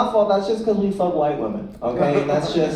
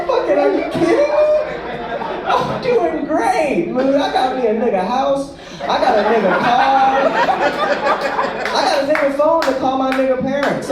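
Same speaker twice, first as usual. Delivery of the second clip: higher, laughing boast; gloating brag; 9 semitones higher than usual. Retold or imitated. Bragging to the heckler about his life, laughing and high. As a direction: brag it up, delighted.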